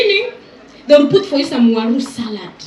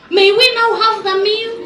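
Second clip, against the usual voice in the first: higher, quicker mock plea; 9 semitones higher, 6 dB louder, faster than usual. Hungry guest begging for the meal, higher. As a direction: beg for the meal in a pitched-up voice. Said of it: "you're like".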